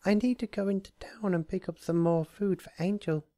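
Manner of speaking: high, hushed and timid; breathy Fluttershy whisper. A narrator's reading. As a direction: whisper it like a shy pony. Fluttershy voiced by narrator.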